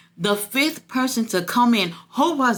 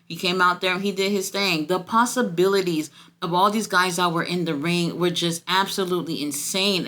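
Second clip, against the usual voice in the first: ordinary talk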